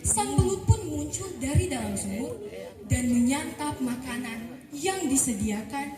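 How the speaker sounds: ordinary delivery, nothing marked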